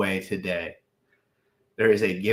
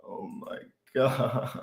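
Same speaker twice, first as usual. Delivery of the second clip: laughing in disbelief; 7 dB quieter, slower than usual. Real, not acted. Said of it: "I'm having a manic episode".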